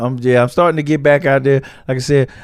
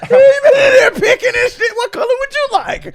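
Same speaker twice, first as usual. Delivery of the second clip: laughing; 4 dB louder than usual. Real, not acted. Mocking friend picking bean colors.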